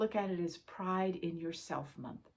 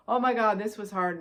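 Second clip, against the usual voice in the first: mock exasperation; 2 semitones higher, 7 dB louder than usual. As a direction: blurt it out like a tired, overwhelmed person. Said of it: "and going".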